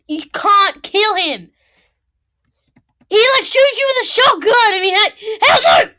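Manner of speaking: high, loud rising rant; excited yelling. Real, not acted.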